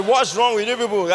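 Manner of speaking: loud, fed-up outburst; retold angry shout at the neighbour; exasperated shout. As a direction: yell at them for wasting your time. Angry at friend's games.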